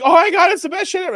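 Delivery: high, loud gush; imitating an infatuated guy, high and loud; mock raving. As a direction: squeal it like an over-excited convert. Mimics guys raving about sex.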